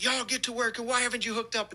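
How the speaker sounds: high, barking yell of a fire chief; mimicking the chief's shouted commands. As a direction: yell orders at the crew. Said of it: "he's yelling".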